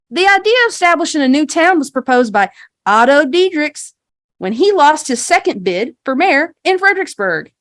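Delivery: tour guide spiel. Acted. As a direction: recite a folksy town history to visitors. Game master voices guide's rehearsed spiel.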